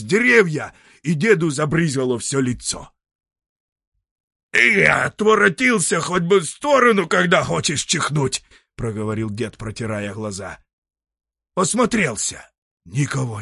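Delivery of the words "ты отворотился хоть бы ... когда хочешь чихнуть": scolding. Acted. Grandfather scolds the unseen sneezer.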